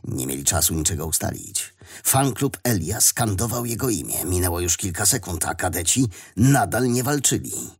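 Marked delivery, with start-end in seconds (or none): none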